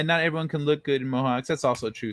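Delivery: usual speaking voice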